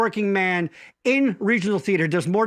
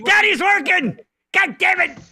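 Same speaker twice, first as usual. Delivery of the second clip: high, loud fed-up shout; a frazzled dad blowing up, pitched up; Larry yelling; 10 semitones higher, 4 dB louder than usual. Retold or imitated. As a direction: bark it at the kids. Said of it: "say that again, Larry".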